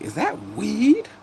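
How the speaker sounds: high, drawn-out incredulous query; imitated surprised question. Streamer voices Tori walking in on auntie, high drawn-out surprise. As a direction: stretch it out high, rising in mock surprise.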